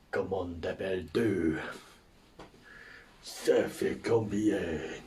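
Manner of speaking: low, slow mock French accent; put-on French accent. English tourist trying French on the locals, put-on French accent. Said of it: "I do it with the accent".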